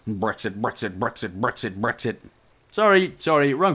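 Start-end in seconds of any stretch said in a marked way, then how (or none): 0.1-2.2 low, quiet repetitive mumble; mock political muttering. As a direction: chant it under your breath, deadpan. Mock rant on Brexit views.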